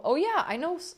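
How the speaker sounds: normal talking voice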